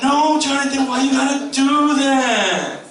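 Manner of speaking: very high, loud, slow pleading parent; mock exasperated parent; whiny parent. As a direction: wail it like a helpless parent. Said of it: "the parents are like".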